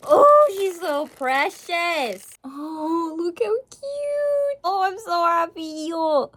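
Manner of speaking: slow, high, drawn-out gushing. Real, not acted. Vlogger cooing over a precious figure, slow high gushing. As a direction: coo over it.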